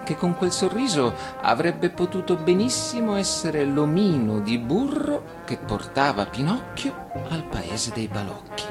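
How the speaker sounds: ordinary delivery, nothing marked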